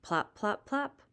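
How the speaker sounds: ordinary delivery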